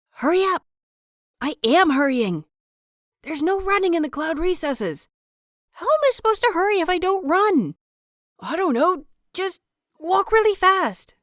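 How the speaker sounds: put-on high, urgent kid voices hissing back and forth; excited children's whispers. A narrator's reading. Novices bickering as they rush to class, high and urgent. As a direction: squeak it like novices rushing in secret. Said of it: "the furiously whispered conversation".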